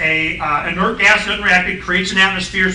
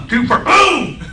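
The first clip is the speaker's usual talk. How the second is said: sudden boom; 9 semitones higher, 4 dB louder, slower than usual. Retold or imitated. Sudden realisation, exclaims boom.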